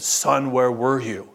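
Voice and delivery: low, slow stern dad voice; imitating a big stern man; stern father. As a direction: question your son low and slowly, sternly. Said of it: "very stern".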